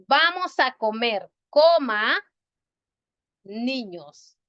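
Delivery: "Vamos a comer", then a clear pause, then "niños"; comma pause before niños. Shown as a demonstration. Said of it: "Pero si yo digo".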